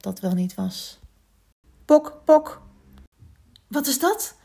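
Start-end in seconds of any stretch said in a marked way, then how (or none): 1.8-2.6 high, loud popping "pok"; imitated knocking. A narrator's reading. 3.7-4.4 high-pitched startled question; suddenly woken, taken aback. A narrator's reading. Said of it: "Verschrikt sprong Tolly op".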